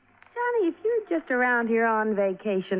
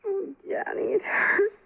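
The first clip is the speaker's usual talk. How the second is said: higher, tearful whimper; crying in pain; 9 semitones higher, slower than usual. Acted. Injured woman pleading to Johnny, tearful pained whimper. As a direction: sob it out, hurting.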